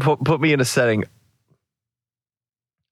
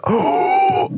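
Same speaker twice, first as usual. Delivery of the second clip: sharp high gasp. Real, not acted. Excited idea gasp.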